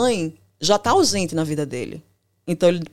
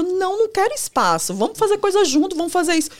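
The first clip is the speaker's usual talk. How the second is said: higher, pleading clingy voice; 10 semitones higher than usual. Retold or imitated. Anxious partner pleads against the avoidant, high and clingy. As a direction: beg to stay close, voice lifted high. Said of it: "E eu".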